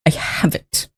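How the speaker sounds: breathy whispered aside. Real, not acted. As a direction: say it under your breath. Muttering to self, searching.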